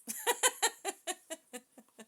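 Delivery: high, loud helpless laughter; loud laughing fit. Real, not acted. Reader repeats that the beautiful home has toilets, helpless laughter. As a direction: laugh it out fully.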